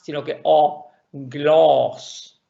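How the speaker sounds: O, gloss with a long rounded O; long vowel demo. Shown as a demonstration. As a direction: model the O, then gloss, held. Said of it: "Traten de hacerla".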